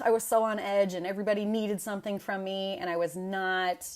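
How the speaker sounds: ordinary talk, nothing marked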